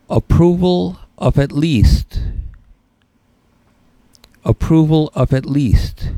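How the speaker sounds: slow, emphatic dictation; dictating. Shown as a demonstration. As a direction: spell it out slowly for notes. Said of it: "So write this next test term down".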